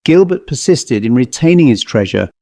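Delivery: plain reading voice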